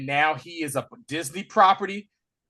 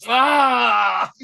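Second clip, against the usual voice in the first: louder, higher cry; mock shout; 6 semitones higher, 6 dB louder than usual. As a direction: yell in shock. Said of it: "I was like".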